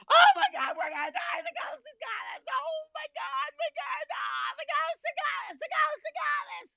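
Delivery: high-pitched mock-terrified wail; shrieky, over-the-top scaredy-cat; panicked ride passenger.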